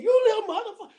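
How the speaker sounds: put-on shrieks it at the boy, high and loud; a mother exploding at her son, half laughing; mock furious yell. Mother bursting into the room, yelling at her son. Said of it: "she said".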